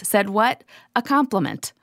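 ordinary talk, nothing marked

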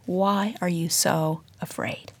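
slow, soft near-whisper; hushed, gentle question. Retold or imitated. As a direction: ask it softly and slowly, to the heart. Jesus speaking to listeners' hearts.

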